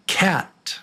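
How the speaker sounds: "cat" said high with a flat front "a"; quiz word demo. Shown as a demonstration. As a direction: say "cat" slowly for listening.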